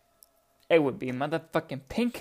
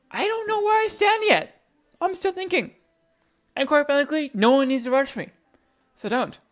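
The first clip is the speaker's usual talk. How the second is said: squeaky, much higher, defiant; 11 semitones higher, 6 dB louder than usual. Real, not acted. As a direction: pitch it way up and declare it. Defiant high-pitched declaration.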